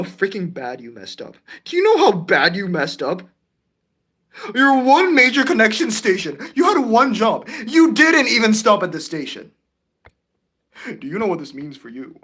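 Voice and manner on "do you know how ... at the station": loud, raised-pitch telling-off; exasperated play-acted dressing-down; mock angry scolding. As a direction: berate the culprit with playful outrage. Scolds the train for its blunder.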